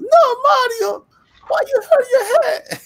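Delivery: very high, loud fake Italian wail; distraught cartoon Italian squeal, drawn out; Luigi. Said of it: "Luigi comes out and goes".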